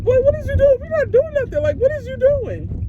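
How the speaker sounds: high, squeaky mock disbelief; incredulous scolding. Retelling her incredulous scolding of him.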